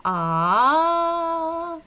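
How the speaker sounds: high, loud, drawn-out A; long held A. Shown as a demonstration. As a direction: stretch the vowel A out long and high. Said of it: "we found out that".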